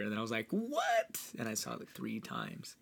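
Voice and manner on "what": high-pitched squeal of disbelief; shocked what. Retold or imitated. Supporter watching a friend's play, high and shocked. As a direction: act startled in one high word.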